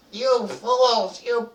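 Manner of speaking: put-on high, loud old goblin voice; exasperated old goblin shrieking at fools; old goblin scolding. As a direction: scold the young goblins, drawing words out. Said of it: "There's one old goblin".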